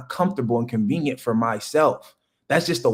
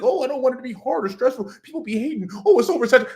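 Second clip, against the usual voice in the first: higher, fast mocking whine; mock whiny excuse-maker; 9 semitones higher, faster than usual. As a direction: mimic the complainers, higher and quick. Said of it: "and they be like".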